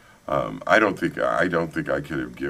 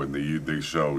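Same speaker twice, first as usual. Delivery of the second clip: ordinary delivery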